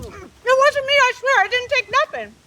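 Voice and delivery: high, loud frantic protest; panicked denial. Acted. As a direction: blurt it out in a panic.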